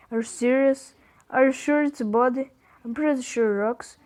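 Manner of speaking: ordinary delivery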